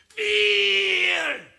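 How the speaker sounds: Fear yelled high and loud; shouted word. Shown as a demonstration. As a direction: blast out Fear to drown phones. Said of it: "소리를 지르냐면".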